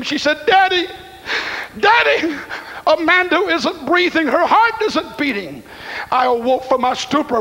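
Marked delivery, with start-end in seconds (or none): 0.5-5.6 higher, frantic cry of Daddy, half-performed; retold scream. Daughter calling her father at night, retold as a higher panicked cry. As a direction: relay a panicked girl's call, higher but not a full scream. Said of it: "and with a scream".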